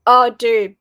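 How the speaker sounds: ordinary talk, nothing marked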